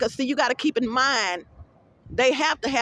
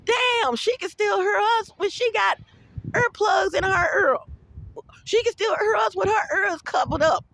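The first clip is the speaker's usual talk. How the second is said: mock shouted quote; 8 semitones higher than usual. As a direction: shout it like the nosy neighbors caught off guard. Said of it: "Do you know they said".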